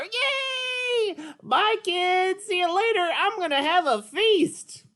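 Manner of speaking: high, bright puppet character, eager; Petrie puppet voice. Acted. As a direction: play the little pterodactyl, keen to try.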